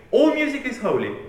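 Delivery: mock boastful proclamation; lightly mocking boast. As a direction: sneer it as their proud slogan. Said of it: "it boasts".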